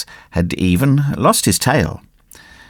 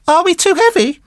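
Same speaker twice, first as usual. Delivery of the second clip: put-on high-pitched puppet boy voice; puppet boy asking. Puppet boy on the tunny's back, high questioning voice. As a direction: ask in a little high voice. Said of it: "asked Pinocchio".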